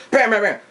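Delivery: high, rapid spoken gunshots; mouth-made gun bursts; imitated gunfire. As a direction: fire off the shots with your voice.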